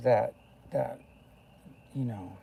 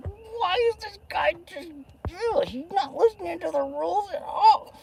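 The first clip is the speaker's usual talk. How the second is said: much higher, exasperated mock voice; mocking control freak; 4 dB louder than usual. Mocking control freaks annoyed he won't follow the rules. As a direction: mimic a frustrated rule-lover. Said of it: "They're just like".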